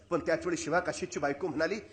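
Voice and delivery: normal talking voice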